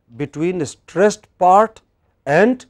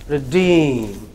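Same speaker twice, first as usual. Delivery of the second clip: "Redeem" with "Re" reduced and "deem" long; stress on second syllable. Shown as a demonstration. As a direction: barely say "Re", stretch out "deem". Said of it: "It is reduced. It's very short".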